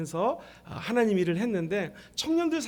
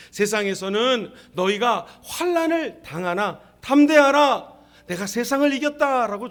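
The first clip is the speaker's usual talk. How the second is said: scripture proclamation; 6 dB louder than usual. Real, not acted. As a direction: declare it firmly, phrase by phrase. Preacher proclaims scripture verse.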